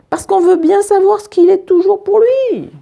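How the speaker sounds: put-on high-pitched eager kid narrator; eager curiosity. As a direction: pitch it way up, dying to know. Narrator acts the curious class.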